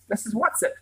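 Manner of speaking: sharp rising question, eyeing the stranger; high wary query; suspicious. A narrator's reading. Charles confronting the odd visitor at the door, suspicious. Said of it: "Charles said suspiciously".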